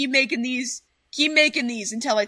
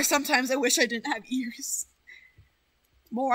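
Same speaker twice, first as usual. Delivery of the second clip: usual speaking voice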